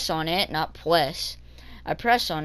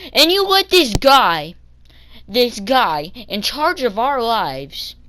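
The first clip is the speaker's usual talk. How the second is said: louder, higher outraged protest; indignant disbelief; 4 semitones higher, 6 dB louder, slower than usual. Retold or imitated. Kid to bus bosses about unfit driver, indignant disbelief. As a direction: challenge them, stressing it loudly.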